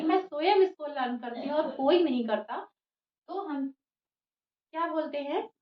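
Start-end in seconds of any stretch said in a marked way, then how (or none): 0.0-2.7 स्वयं said louder with force; stress on स्वयं. Shown as a demonstration. Teacher demonstrates emphasis to students, स्वयं stressed. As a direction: lean hard on स्वयं, nobody else did it. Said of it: "स्वयं पे ज्यादा जोर दे के बोला".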